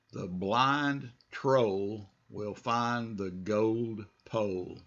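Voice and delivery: very slow, clear read for repeating; slow model read. Shown as a demonstration. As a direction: spell it out slowly for students. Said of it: "just follow me".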